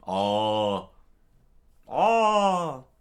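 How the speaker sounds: loud rising yells; comic cries; cries of horror. Acted. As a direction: yell out in panic. Victims being eaten.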